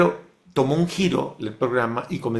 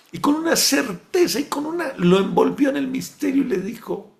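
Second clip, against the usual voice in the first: much higher and louder, awed; rapt, soaring; 13 semitones higher, 4 dB louder than usual. Acted. Preacher tells the Virgin's answer in rising awe. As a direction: lift the voice in wonder.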